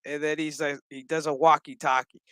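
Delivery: usual speaking voice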